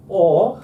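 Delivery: OR swooping up then down, loud and drawn out; up-and-down pitch accent. Shown as a demonstration. Teacher explains the squiggle on top, up-and-down glide. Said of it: "That means go up and down".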